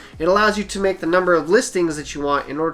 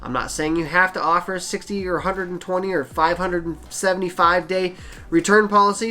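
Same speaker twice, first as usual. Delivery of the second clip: usual speaking voice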